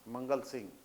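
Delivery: ordinary talk, nothing marked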